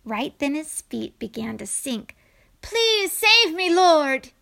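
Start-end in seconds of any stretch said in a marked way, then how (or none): 2.6-4.3 high, loud plea to be saved; frightened, urgent shout; desperate prayer. A narrator's reading. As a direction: cry out as you start to sink. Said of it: "he prayed".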